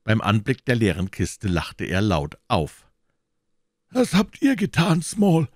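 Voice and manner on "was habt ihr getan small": höher und lauter, grimmig gefragt. A narrator's reading. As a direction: als Jones den Gefangenen grimmig anfahren. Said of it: "sagte Jones grimmig". (Jones fährt Small wegen des leeren Kastens an, grimmig)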